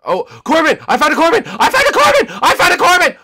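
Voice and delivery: very high, loud shrieks; frantic, piercing excitement; excited screaming. Real, not acted. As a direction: squeal the discovery over and over. Spots hidden player.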